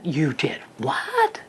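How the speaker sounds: drawn-out mock amazement; excited parent. Shown as a demonstration. Parent reacting to child's made bed, mock excited. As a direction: stretch it out in exaggerated, hushed astonishment. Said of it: "you gotta get excited here".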